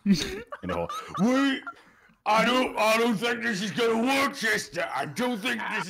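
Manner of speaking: high, loud panic; frantic shouting. Acted. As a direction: yell while stuck and flailing. Panicked struggle in the cave.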